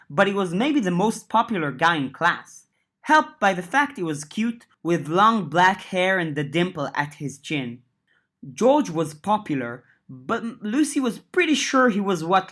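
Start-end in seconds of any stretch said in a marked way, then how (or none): none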